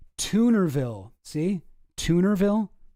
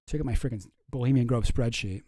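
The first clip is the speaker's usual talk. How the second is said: usual speaking voice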